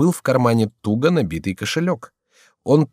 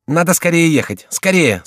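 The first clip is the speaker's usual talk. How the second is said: higher, louder urging; insistent hurry-up; urgent command; 8 semitones higher, 4 dB louder than usual. A narrator's reading. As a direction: press them to hurry. Chicot spurring on, urging haste.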